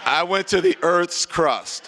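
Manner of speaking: slow, high and loud through laughter; real gleeful laughter; laughing. Real, not acted. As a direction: savour the punchline while laughing. Laughing retelling his comeback.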